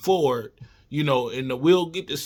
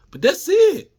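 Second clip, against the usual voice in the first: excited; 13 semitones higher, 6 dB louder than usual. Real, not acted. Sudden high burst mid-analysis.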